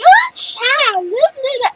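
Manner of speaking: very high, loud sassy squeal. Retold or imitated. Mimicking how people expect her to sound. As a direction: overdo the sassy lady voice. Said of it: "people are gonna be like".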